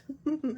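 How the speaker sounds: short high gleeful laugh; delighted witch laugh. Acted. Witch happy with her found root, gleeful laugh. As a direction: laugh with glee at the find.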